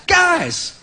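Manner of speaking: attention-getting call. Retold or imitated. Announcing his post to classmates.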